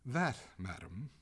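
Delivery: slow, deliberate address; measured and slow. A narrator's reading. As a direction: draw the words out deliberately. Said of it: "said the master slowly".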